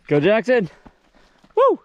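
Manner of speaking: loud cheering shout. Real, not acted. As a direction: yell encouragement across the room. Cheering a rider during trial.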